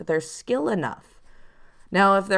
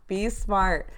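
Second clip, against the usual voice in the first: laughing, softer repeat; 3 dB quieter than usual. Real, not acted. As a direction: say it through a laugh.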